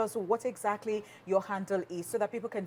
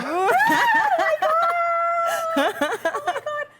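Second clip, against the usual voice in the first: laughing shriek; 5 dB louder, slower than usual. Real, not acted. Shrieking at the accent.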